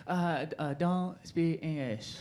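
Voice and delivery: slow, halting broken English; a deliberately bad, slow hesitant impression; bad impression of the woman. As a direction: haltingly fake not speaking English. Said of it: "like, a bad impression".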